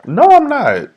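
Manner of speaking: high-pitched denial; high protest. Real, not acted. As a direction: squeal it out in protest. Protesting being called greedy.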